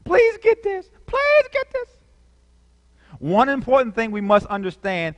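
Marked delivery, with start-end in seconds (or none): 0.0-1.9 much higher, pleading cry; urgent plea. Real, not acted. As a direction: beg them to catch it. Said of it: "This is so important, folks".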